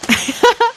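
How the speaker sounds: high giggling laugh; a quick gleeful cub laugh; cheeky laugh. Acted. Lion cub laughs at his own prank with a high cheeky giggle.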